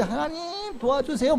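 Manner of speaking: high, slow plea; cling to God with a drawn-out cry for help; pleading prayer. Retold or imitated. Struggling believer begging God for help, high and slow. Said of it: "힘들 때".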